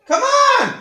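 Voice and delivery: high, loud shout of encouragement; cheering shout. Real, not acted. Teacher cheers on student Alex struggling to blow, shouting. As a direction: urge him on as if he's at the finish line.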